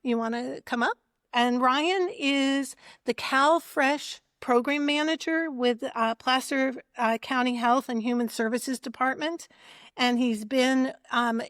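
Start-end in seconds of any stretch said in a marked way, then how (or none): none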